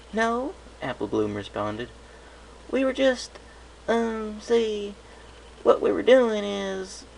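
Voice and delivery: high, squeaky filly stammering excuses; a little filly caught out, fumbling; flustered filly. Acted. Apple Bloom caught by her sister, squeaky and flustered.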